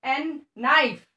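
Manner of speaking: "नाइफ" begun on a held N, no K sound; silent K. Shown as a demonstration. Teacher shows pupils to start from N, silent-K pronunciation. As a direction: draw out the N, then say "नाइफ". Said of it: "हमें एन से start करना पड़ेगा".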